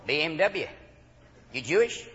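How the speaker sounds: quiet, low, lightly voiced traffic cop; Southern cop. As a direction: question the driver low and softly. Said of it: "they pull you over and go".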